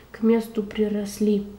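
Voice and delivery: usual speaking voice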